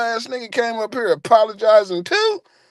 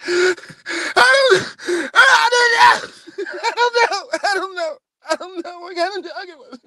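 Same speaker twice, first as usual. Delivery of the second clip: much higher, wailing through sobs; mock hysterical crying; 11 semitones higher than usual. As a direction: wail it helplessly. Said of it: "I said".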